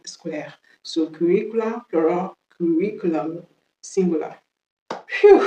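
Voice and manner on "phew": loud, high whooshing "phew"; exaggerated end-of-task exhale; relieved phew. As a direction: blow out a big sigh of relief.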